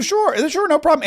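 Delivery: mock eager helper. Host voicing federal government agreeing, high mock cheer. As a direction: chirp it like an eager clerk. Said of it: "the federal government will do".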